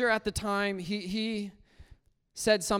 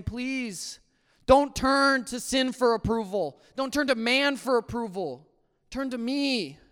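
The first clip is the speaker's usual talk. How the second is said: raised-pitch pleading, as if calling out; 5 semitones higher, 5 dB louder than usual. Retold or imitated. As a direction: implore in a higher, insistent voice. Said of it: "calling out to me, saying".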